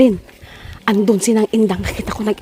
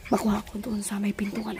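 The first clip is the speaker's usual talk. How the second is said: whispering while hiding; 7 dB quieter than usual. Real, not acted. Hiding before the prank.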